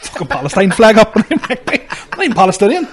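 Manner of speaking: laughing through the punchline; real amused laughter. Real, not acted. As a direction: laugh the words out in disbelief. Laughing while telling the story.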